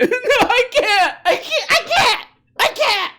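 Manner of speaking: high, slow, laughing wail; mock crying tantrum. Mocking a grown man's test tantrum, high laughing wail. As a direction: wail like a kid refusing. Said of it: "you have to take it to".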